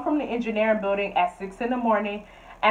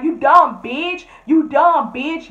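slow, louder mocking yell; mock yelling friend; 5 semitones higher, 6 dB louder, much slower than usual. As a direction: drag out the insult, yelling. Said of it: "yelling and shit".

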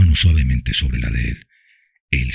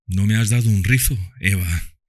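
sad smiling father; 5 semitones higher than usual. A narrator's reading. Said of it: "con una sonrisa triste".

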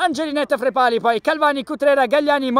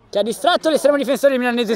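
ordinary talk

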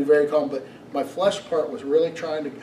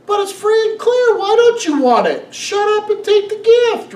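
much higher, louder exasperated fatherly scolding; a fed-up parent pushing a gift, pitched way up; 9 dB louder than usual. Pretend. Preacher voicing God as a fed-up father, loud and high. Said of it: "Father's like".

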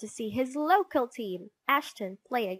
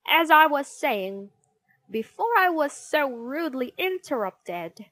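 loud, high, indignant resumption; irritated teacher; 6 semitones higher, 7 dB louder than usual. A narrator's reading. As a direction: rebuke the pupil who cut in. Teacher glares at interrupting pupil.

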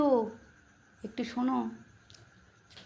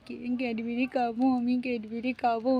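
pretend baby crying; 10 dB quieter than usual. Baby begging Mishtu for Cadbury, pretend crying. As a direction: whimper softly like a baby begging for a treat. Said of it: "তোমার baby কাঁদছে কেন".

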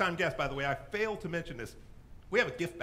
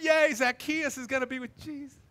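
high, loud mock cheer; hammed-up excited applauding fans; excited crowd; 7 semitones higher, 6 dB louder than usual. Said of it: "They were so excited".

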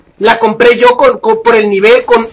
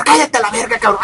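soft grumbled put-down; quick, hushed brush-off; dismissive retort; 3 semitones lower, 6 dB quieter than usual. Acted. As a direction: mutter it at the complainer, a little lower. Same retort to imagined complainers.

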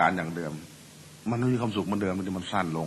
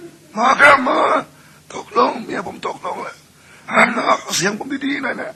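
very high, strained croak; hoarse husband voice; 3 dB louder than usual. Retold or imitated. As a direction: plead with the doctor in a cracked rasp. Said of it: "เสียงแหบ". (ผัวเสียงแหบขอให้หมอช่วย)

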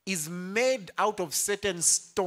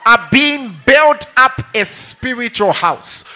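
slow, loud, drawn-out scripture; loud, slow preaching of the verse; forceful preaching; 8 semitones higher, 18 dB louder, slower than usual. Acted. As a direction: proclaim each word to the back row.

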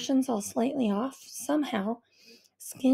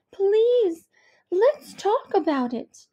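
higher, coaxing mother's plea; voiced mother; 8 semitones higher, 6 dB louder, much slower than usual. A narrator's reading. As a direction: gently coax the daughter to open up. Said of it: "Mom pleading through the door".

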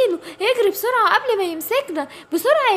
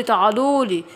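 low, coaxing call to its prey, falling; a low, satisfied beckon; hungry tiger; 7 semitones lower than usual. Acted. (نمر جعان بيقول تعالولي بنبرة نازلة)

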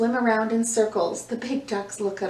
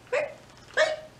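very high chirping; cricket chirps. Shown as a demonstration.

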